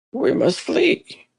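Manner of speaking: high, slow, pleading voice; frightened plea. Acted. Frightened Hrothgar urging the men to flee, high and slow. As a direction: beg to run away in fear.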